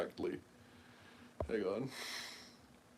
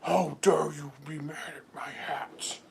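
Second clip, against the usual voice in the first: slow, whispery put-on indignation; mock angry seller; slower than usual. Seller getting all mad over his hats, mock indignant. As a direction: imitate an offended vendor, drawn out and airy. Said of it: "he was just getting all mad".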